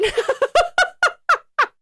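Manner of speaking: shrill tired laughter; exhausted burst of laughter; high giggle fit. Real, not acted.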